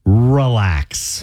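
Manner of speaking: slow, smug mock-husband relax; husband's annoying relax. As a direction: stretch out a patronizing relax. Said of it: "That's how he says it".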